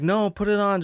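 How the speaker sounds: chirpy firm mom; insistent mom. Retold or imitated.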